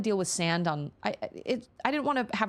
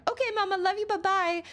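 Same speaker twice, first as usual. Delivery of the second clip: put-on much higher, sing-song little-girl goodbye; high, breezy little-girl farewell; sweet toddler voice. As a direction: chirp it like a cheerful toddler.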